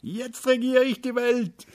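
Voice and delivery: high, loud cry of triumph; jubilant cheer. A narrator's reading. Little day cheering to the world, high and jubilant. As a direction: crow it out like a child who owns the world. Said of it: "Der kleine Tag jubelte".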